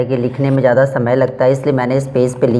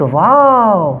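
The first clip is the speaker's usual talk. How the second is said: wow; 7 semitones higher, 4 dB louder, much slower than usual. Shown as a demonstration. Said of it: "वाओ word लगा दिया".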